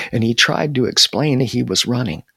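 usual speaking voice

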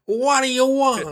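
put-on Brooklyn accent. Co-host copying the pretend secretary, high-pitched Brooklyn accent. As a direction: echo it as a hammed-up Brooklyn receptionist. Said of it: "like, a Brooklyn accent".

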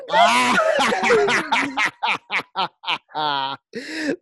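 high shrieking horror villain cackle; Vincent Price laugh. Pretend. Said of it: "Trying to do my best Vincent Price laugh".

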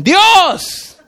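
high, drawn-out call from above; called-out answer. Acted. Voice from above names itself, calling out. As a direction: announce it from on high. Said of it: "Y le dicen".

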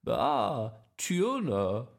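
loud, relishing grandma voice. Acted. Grandma piling tins into the trolley, relishing. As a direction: draw it out like a delighted grandma. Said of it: "said Grandma".

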